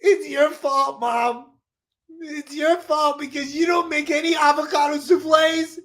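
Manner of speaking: put-on high accusing kid voice; a sulky child blaming mom; whiny child voice.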